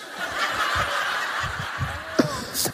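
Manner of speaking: airy, high-pitched burst of laughter; breathy high laugh. Real, not acted. Laughs at wife recognising pastor.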